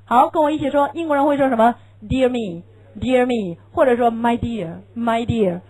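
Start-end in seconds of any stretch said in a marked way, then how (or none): none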